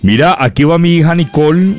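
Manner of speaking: normal talking voice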